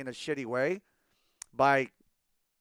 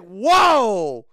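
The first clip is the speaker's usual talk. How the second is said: loud, raised-pitch retold alarm; shocked protest; 8 semitones higher, 10 dB louder, much slower than usual. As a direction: call a halt, loudly stunned. Acting out his shocked reaction.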